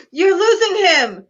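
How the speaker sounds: hammed-up emergency alarm; panicked cry. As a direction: plead urgently for the patient. Patient flatlines in sketch.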